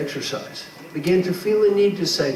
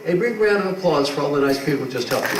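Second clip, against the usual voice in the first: usual speaking voice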